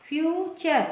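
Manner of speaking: फ्यूचर in two clear parts, "fyu-chur"; T read as CH. Shown as a demonstration. Teacher answering her own pronunciation question, फ्यूचर with "ch". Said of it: "इसका pronunciation क्या होगा".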